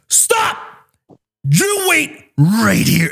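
a mock command that slows down; yelling stop, then holding them there; retold yell. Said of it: "and I'm like".

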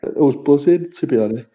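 talking through a yawn. Real, not acted. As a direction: yawn while saying it. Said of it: "Sorry, I'm yawning".

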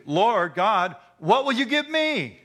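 high, loud, strained plea to God; pleading with God, voice pitched high; Abram pleading. Retold or imitated. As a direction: cry up to God like a desperate old man. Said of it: "But Abram said".

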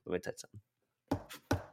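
hushed secret whisper; breathy conspiratorial whisper. Real, not acted. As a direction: lean in and whisper it. Conspiratorial aside to co-host.

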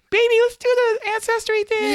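put-on very high, loud sweet-talking girlfriend voice; mimicking his girlfriend. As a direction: coo it up high like a doting partner. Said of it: "She's like".

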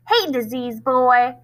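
slow taunting call, lightly voiced bully; mild mock shout. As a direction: drag it out to mock him. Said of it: "Norton shouted".

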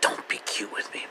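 whispered cat warning; a whispered feline warning; voicing the cat. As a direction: breathe it as a disdainful cat. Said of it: "It's like".

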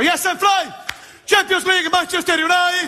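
high shouted fake English; mock singalong shout. Dancer faking English lyrics, shouted high. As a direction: belt the words like a clueless fan. Mouthing along to English songs.

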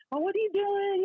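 high, tearful pleading, imitated; mock crying. Confronting her cheating man by phone, mock crying. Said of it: "So I'm crying".

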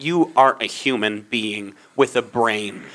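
low, slow, quiet disbelief; incredulous. Retold or imitated. Worker staring at unreasonable customer, low slow incredulity. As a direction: spell it out slowly in stunned disbelief.

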